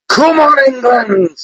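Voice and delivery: high-pitched football fan's cry; rallying cheer. Pretend. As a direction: call it out high to rally the team. Cheer performed on host's request.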